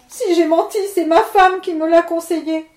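a touch of weeping as he blames his wife; tearful plea. Acted. Miller on his knees begging Levent, mock tearful. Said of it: "dit-il en pleurant".

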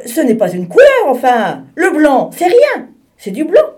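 the penguin finally loses his temper; irritated outburst. Acted. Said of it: "qui commençait à s'énerver".

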